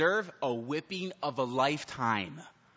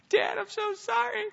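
put-on much higher child's voice pleading; begging child. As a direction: squeak out an apology like a scared kid. Son begs dad before spanking.

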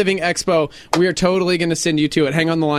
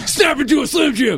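Macho Man impression; 5 semitones higher than usual. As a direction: yell it like a pro wrestler. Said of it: "That's Macho Man".

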